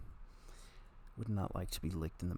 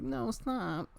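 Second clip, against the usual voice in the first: mock protest; 8 semitones higher than usual. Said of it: "I'm like".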